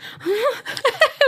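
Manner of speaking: high squealing laugh. Real, not acted. Big laugh at singing tease.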